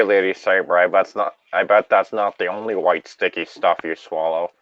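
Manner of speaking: ordinary delivery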